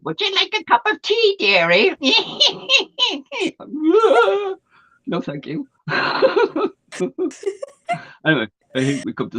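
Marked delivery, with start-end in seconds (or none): 0.0-1.9 put-on high sweet quavering old woman voice; dotty absent-minded old lady. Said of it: "She called up the stairs once and said". 2.0-4.5 put-on high cackling old woman laugh; old lady cackle. 5.9-6.7 put-on high wheezy old lady chuckle; old lady cackle.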